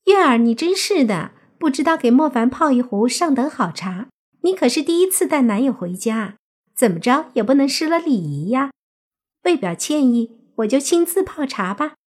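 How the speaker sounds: high-pitched mock scolding; high, gushing host voice; fussy, fawning relative. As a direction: chide her sweetly for the guest's benefit. Voices relative fussing over guest.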